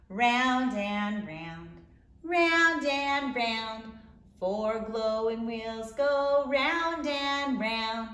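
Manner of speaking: plain reading voice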